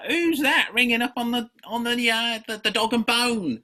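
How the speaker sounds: a playful London Cockney voice; Cockney accent. Retold or imitated. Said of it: "Apologies to any residents of London there for the accent".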